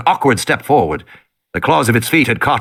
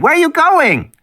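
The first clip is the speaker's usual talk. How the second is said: put-on girl calling out. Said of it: "Lex said".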